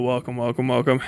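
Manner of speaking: ordinary delivery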